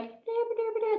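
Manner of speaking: high thin soft dee dee; tiny held-back singing; timid singer. Shown as a demonstration. Teacher mocking a singer not letting sound out. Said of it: "they're not like".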